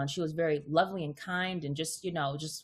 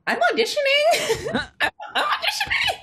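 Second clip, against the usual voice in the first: laughing quote; 9 dB louder than usual. Retold or imitated. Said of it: "I was like".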